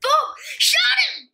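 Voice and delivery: loud gleeful yell; excited shout. Real, not acted. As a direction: shout it in triumph. Kid shooting in game.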